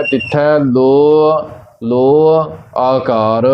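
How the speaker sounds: slow ਲੋ as "lo-a", each one separate; clear final vowel. Shown as a demonstration. Teacher repeating the correct reading, long separated ਲੋ as "lo-a". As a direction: stretch each ਲੋ and finish it with "a". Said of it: "ਇਸੇ ਪ੍ਰਕਾਰ ਹੀ ਕਰਨਾ ਹੈ".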